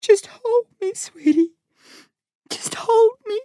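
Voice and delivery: a mum begging her son for a hug in tears; weeping mother. A narrator's reading. Weeping mum begs her son to hold her. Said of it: "Mum weeps harder".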